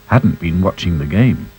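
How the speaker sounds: plain reading voice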